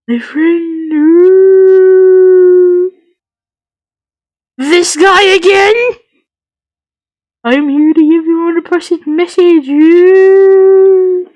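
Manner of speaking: high, slow, wailing ghost; ghostly spirit voice. Acted. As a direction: moan it like a spirit.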